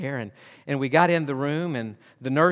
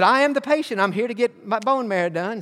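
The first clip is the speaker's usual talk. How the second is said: higher and louder chirpy answer; cheerful mom; 7 semitones higher, 4 dB louder than usual. Retold or imitated. Mom telling the receptionist she is the patient, chirpy. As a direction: announce it brightly.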